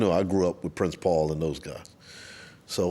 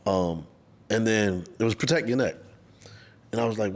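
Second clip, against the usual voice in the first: normal talking voice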